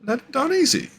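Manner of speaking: high-pitched mock coaxing; squeaky retold plea; mock pleading quote. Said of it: "he's like".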